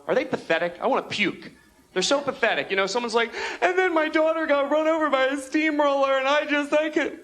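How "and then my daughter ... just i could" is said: high-pitched mock tearful testimony; distraught talk-show guest. Guest telling a talk show about her daughter, mock distraught. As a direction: wail out a tragic story for the cameras.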